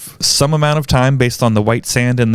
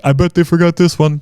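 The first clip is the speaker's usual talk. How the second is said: mock listener; 4 semitones higher than usual. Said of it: "and be like".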